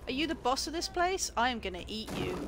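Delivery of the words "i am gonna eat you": low-pitched mock threat; contained, low monster threat; playful threat. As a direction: threaten to eat them, pitched down. Playful threat, first lower take.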